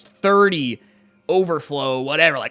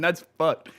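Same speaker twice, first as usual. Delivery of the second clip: normal talking voice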